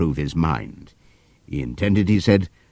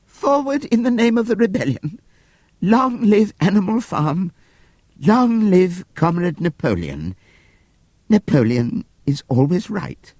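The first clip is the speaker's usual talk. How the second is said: high, slow solemn pig voice; milk the deathbed drama; quoted dying words; 13 semitones higher, slower than usual. Acted. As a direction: Squealer reciting Boxer's last words. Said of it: "he whispered".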